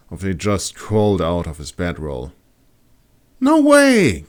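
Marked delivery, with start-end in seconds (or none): 3.4-4.2 high, louder disbelief; protesting. A narrator's reading. Kat protesting the moon is blue, high and loud. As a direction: refuse to believe it. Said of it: "Kat protested".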